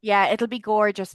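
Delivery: ordinary talk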